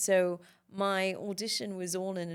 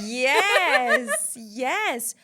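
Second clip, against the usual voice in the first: loud, high, laughing cry of delight; excited yes; 7 semitones higher, 14 dB louder than usual. Real, not acted. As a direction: burst out delightedly, much louder and higher. Delighted answer to interviewer's guess.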